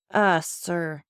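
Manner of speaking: plain reading voice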